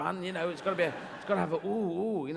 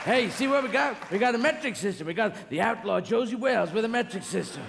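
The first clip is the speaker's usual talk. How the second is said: angry American; 2 semitones higher, 4 dB louder than usual. Retold or imitated. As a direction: run them out of town. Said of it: "you seem to go".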